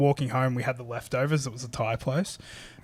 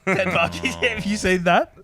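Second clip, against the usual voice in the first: laughing through the words, higher pitched; 5 semitones higher, slower than usual. Real, not acted. Friend laughing at the parking ticket gift story. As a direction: laugh as you repeat the punchline. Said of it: "laughs while retelling".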